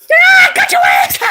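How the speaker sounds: very high, loud gloating yell; triumphant shout. Real, not acted. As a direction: yell in triumph as you catch them. Catching another player.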